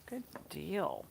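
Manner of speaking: normal talking voice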